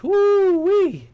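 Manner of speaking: high excited whoop over chocolate Oreos; delighted whoop. Real, not acted. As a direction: whoop with craving. Craving chocolate dip Oreos.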